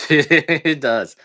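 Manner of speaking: high, loud laughing insistence. Real, not acted. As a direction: crack up laughing as you insist. Laughs while insisting she does.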